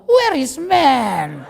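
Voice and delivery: hammed-up pleading search for a man; desperate older woman. Preacher mimics a thirty-year-old woman, drawn-out mock desperation. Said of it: "Tetapi begitu tiga puluh tahun".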